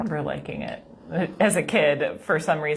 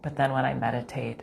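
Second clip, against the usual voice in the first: normal talking voice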